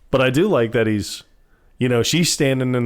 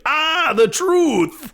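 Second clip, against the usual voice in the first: very high, drawn-out mock shout; mock outcry; 3 dB louder, much slower than usual. Man hit by truth serum confession, hammed-up outcry. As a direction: cry it out high and stretched.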